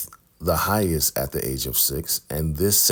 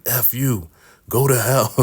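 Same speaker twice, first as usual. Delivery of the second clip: mock angry brush-off; 6 semitones higher than usual. [name] curses River while leaving.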